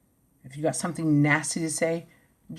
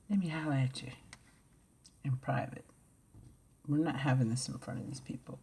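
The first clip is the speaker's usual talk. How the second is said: soft, lowered private word; quiet aside; 4 semitones lower, 8 dB quieter than usual. Retold or imitated. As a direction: pull them aside, speaking low. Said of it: "I say".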